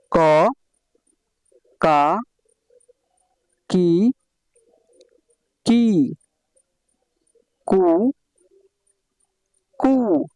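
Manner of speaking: drawn-out classroom chant, each syllable lifted; rhythmic teacher's drill; sing-song recitation. Shown as a demonstration. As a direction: chant the syllables slowly for pupils to copy.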